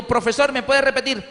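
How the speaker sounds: students asking. Retold or imitated. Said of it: "Y yo le digo".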